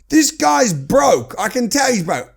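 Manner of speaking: slow, loud and high, certain; retold shout. Telling his wife the author is broke, slow loud shout. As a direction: hammer it out like a verdict. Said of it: "I'm shouting at my wife".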